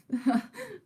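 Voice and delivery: high flustered laugh; shy high laugh; high giggle. Real, not acted. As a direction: giggle when embarrassed. Flustered by donations.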